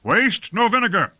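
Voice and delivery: high-pitched shouted command; shouting. A narrator's reading. Lord warns troops attacking the foam, shouted command. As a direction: shout a warning order over the troops. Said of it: "shouted Lord Fayed".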